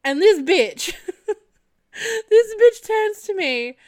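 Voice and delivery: high laughing squeal. Real, not acted. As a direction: crack up mid-sentence. Laughing, can't believe it.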